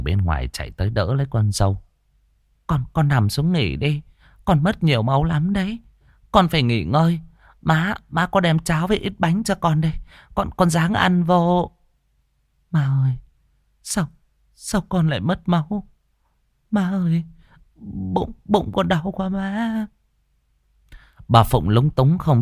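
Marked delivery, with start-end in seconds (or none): 2.7-11.7 higher, gentle coaxing voice of a worried mother-in-law; anxious old mother. Acted. Mother-in-law urging her bleeding daughter-in-law to rest, gently anxious. 12.7-19.9 frightened pleading. Acted. Young wife in pain asking mother-in-law why she bleeds, pleading.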